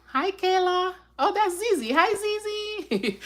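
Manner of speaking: high-pitched sing-out hello; sweet greeting. Real, not acted. Greeting children on the live.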